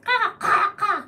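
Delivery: high, loud crow caw; crow imitation. Shown as a demonstration. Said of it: "crows are easy to imitate".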